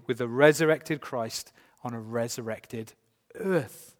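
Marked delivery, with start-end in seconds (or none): 2.0-3.9 pause, then land firmly on "earth"; stress on earth. Real, not acted. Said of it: "I just love to stress that word earth".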